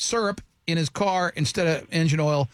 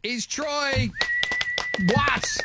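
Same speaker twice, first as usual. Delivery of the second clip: mock announcer; 4 semitones higher than usual. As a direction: present him like a ring announcer. Mock announcer send-off for guest.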